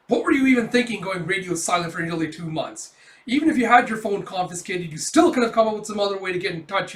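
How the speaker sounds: hammed-up angry friend; mock scolding. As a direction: tell him off for going silent. Ichikawa scolds Kafka.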